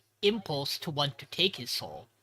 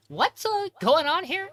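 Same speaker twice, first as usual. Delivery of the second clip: much higher, puzzled voice; confused Asako; 13 semitones higher, 5 dB louder, slower than usual. Retold or imitated. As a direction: squeak it out bewildered.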